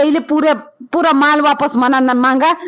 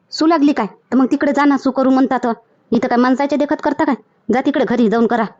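normal talking voice

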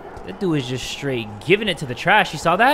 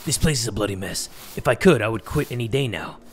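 lower-pitched voice for a grumbling street worker; a lightly voiced, lower weary worker; 8 semitones lower than usual. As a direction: grumble it like a fed-up sweeper. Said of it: "The street worker said".

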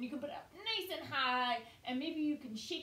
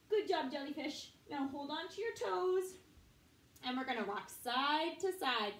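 normal talking voice

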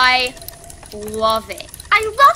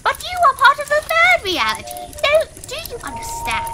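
Papyrus voice; 10 semitones higher, 3 dB louder than usual. Acted. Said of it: "I keep mixing my papyrus voice".

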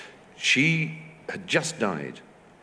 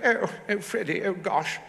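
put-on high-pitched period English middle-class accent; Celia Johnson; 12 semitones higher than usual. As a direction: mimic an old British film accent. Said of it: "a sort of Celia Johnson accent".

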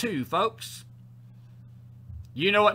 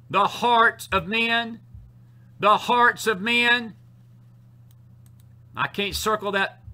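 slow, high, loud drawn-out refrain; full-force preaching; 5 semitones higher, 8 dB louder, much slower than usual. Real, not acted. Preacher circling the heart of men, full-force preaching. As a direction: stretch each word and proclaim it over and over.

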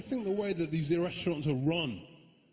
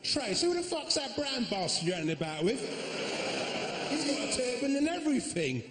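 higher, indignant shout-across act; outraged heckler; 10 semitones higher than usual. Acted. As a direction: lightly put on a scandalised busybody. Acted heckler spotting brown boyfriend.

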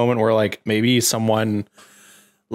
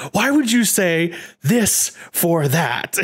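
higher, slow, exasperated whine; mock angry Peter; 6 semitones higher, slower than usual. Peter snapping at his teammate in mock anger. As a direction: act out a bratty, offended outburst. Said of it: "Peter gets mad".

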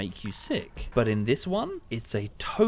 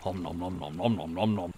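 nom nom chomping; 5 semitones lower than usual. Shown as a demonstration. Dinosaur drinking from lake.

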